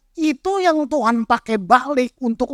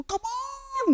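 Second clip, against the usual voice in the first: mock worship leader cry; 13 semitones higher, 13 dB quieter than usual. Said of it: "Kita kan suka itu".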